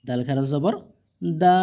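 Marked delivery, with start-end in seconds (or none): 1.2-1.6 long, steadily held vowel; drawn-out vowel. Shown as a demonstration. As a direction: stretch the vowel out evenly. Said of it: "এগুলো টেনে পড়তে হবে". (টেনে পড়া, লম্বা স্বর)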